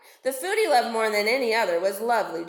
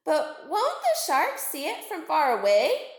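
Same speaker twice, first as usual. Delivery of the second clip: higher, innocent little dolphin; young dolphin; 5 semitones higher than usual. Acted. The youngest dolphin asks her mother, in a higher voice. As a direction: ask it wide-eyed like a child.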